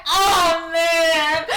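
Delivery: loud, high, drawn-out lament; dismayed outcry. Acted. Merlin hearing his fate from Tisha, hammed-up loud dismay. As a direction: cry it out in mock despair.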